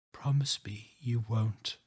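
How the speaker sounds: slow, deliberate whisper. Acted. Rachel begs the narrator not to call, in a slow whisper. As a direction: whisper each word with effort. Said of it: "Her voice is slower, more deliberate".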